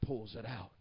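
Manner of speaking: low, very soft storytelling; hushed story narration. Acted. Preacher telling how Daddy pulls out the rock, low and hushed. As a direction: bring it right down for the quiet reveal.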